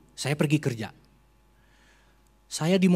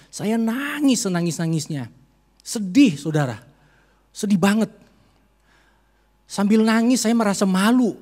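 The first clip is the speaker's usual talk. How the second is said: louder, higher, near sobbing; tearful cry; 6 semitones higher, 4 dB louder than usual. Real, not acted. Preacher reliving his grief before the congregation. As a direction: grief spilling over as you speak.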